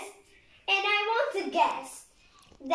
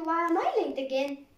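usual speaking voice

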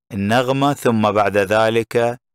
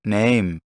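"Name" with the pitch gliding down; falling intonation. Shown as a demonstration. Teacher showing learners where the tune falls, falling intonation on "Name". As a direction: let "Name" drop at the end like a closed question. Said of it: "متى نزلت النغمة".

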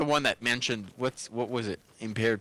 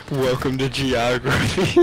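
dim soldier voice; 6 dB louder, slower than usual. Retold or imitated. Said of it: "Impaired troopers be like".

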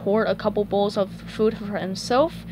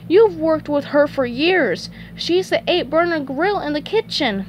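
high, incredulous cartoon voice; SpongeBob shocked; 6 semitones higher than usual. Shocked at his co-worker's ignorance, high disbelief. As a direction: protest high-pitched, in amazement. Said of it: "SpongeBob was shocked".

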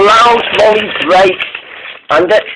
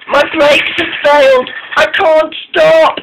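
panicked cry; 12 semitones higher than usual. A narrator's reading. Caitlin warns that her brakes failed, panicked cry. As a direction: cry out in alarm. Runaway engine calling for help.

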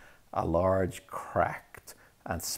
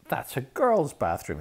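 higher, louder shocked outburst; scandalised prefect gasp; 9 semitones higher, 6 dB louder than usual. A narrator's reading. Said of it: "he gasped".

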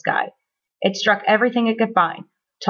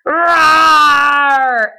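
long, loud, high roar; monster roar; 5 semitones higher, 7 dB louder than usual. A narrator's reading. Monster almost stepping on Chester, roaring loudly. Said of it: "it roared".

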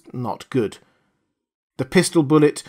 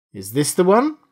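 sharp; 6 semitones higher than usual. A narrator's reading. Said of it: "he asked sharply".

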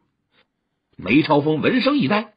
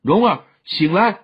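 anxious call, pitched up; urgent calling; 4 semitones higher than usual. A narrator's reading. Youth calls to his fainted sweetheart, anxious. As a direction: call urgently to rouse her. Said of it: "连叫".

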